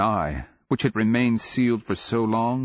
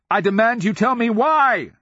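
furious demand; 13 semitones higher, 6 dB louder than usual. Acted. Princess demands an answer.

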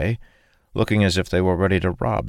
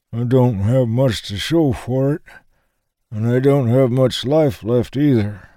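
put-on old man voice; 8 semitones higher, 4 dB louder than usual. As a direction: voice a frail old soldier lost in memory. Old man speaks of his life.

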